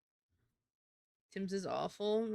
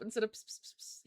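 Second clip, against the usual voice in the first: normal talking voice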